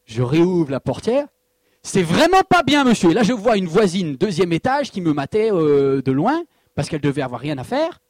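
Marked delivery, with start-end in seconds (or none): 1.8-3.1 louder, higher nagging reproach; hammed-up busybody calling down; mock scolding neighbor. Neighbor from the second floor scolding the pastor, louder mock nag.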